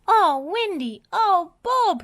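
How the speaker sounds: high, loud frightened cry; panicked robot calling for help; Lofty crying out. A narrator's reading. Lofty runs up crying out to Wendy and Bob in fright. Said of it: "he cried".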